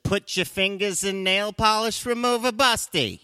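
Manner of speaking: high, insistent mock wife; know-it-all wife. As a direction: sing out the answer, high and loud. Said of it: "And she said".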